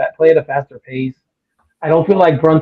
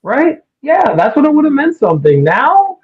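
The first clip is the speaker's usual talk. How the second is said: high-pitched disbelieving rant; worked-up exasperation at a pundit; incredulous rant; 8 semitones higher than usual. Real, not acted. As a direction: push it up high, can't believe this keeps coming up. Ranting at Max Kellerman.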